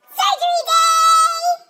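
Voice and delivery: high, slow, loud cheer; excited shout. Acted. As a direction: call it out like a big announcement. Excited announcement of surgery.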